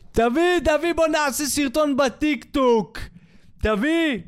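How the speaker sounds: high, loud mock-excited dad; over-eager modern father. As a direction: pounce on the idea like an overgrown kid. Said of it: "האבא". (אבא נלהב בהגזמה, קול גבוה)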